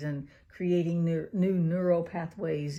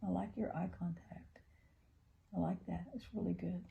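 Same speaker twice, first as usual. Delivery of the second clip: whispered praise; 11 dB quieter than usual. Real, not acted. Mother whispers praise to her son for eye contact. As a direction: praise him in a hush.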